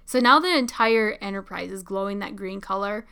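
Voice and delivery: usual speaking voice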